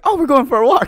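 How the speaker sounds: excited dog's voice, high and loud; Lola. Acted. Owner voicing the dog at walk time, excited. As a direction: burst out like a dog thrilled about a walk. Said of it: "Lola's like".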